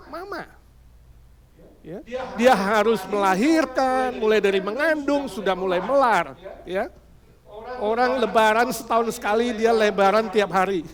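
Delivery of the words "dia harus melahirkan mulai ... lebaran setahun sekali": usual speaking voice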